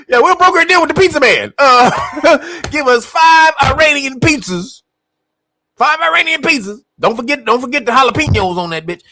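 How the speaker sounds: high, laughing pizza order; mock pizza order. Trump ordering five Iranian pizzas in a high laughing voice.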